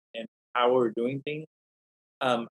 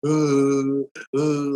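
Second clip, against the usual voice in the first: half-acted kid sobbing; imitated sobbing; 3 semitones higher, 5 dB louder than usual. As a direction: mimic a little boy's crying gasps. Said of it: "I was already crying".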